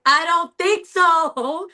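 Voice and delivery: high, slow, stubborn refusal; an inner child refusing, high and slow; defiant inner voice. Retold or imitated. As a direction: dig in your heels, drawn out up high. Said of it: "my inside me was like".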